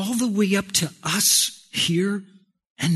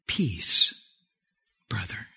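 low and hushed, with a pause; quiet blessing to a brother; calm greeting of peace; 9 semitones lower, 6 dB quieter than usual. Acted. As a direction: hand it over softly, dropping away. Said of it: "Here's one for you".

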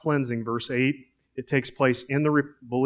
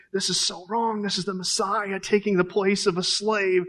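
higher, pleading inner voice of Peter; higher-pitched Peter, upset and unsettled; Peter's inner protest; 5 semitones higher than usual. Acted. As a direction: voice Peter's shocked unease at the Messiah stooping. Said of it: "Peter's thinking".

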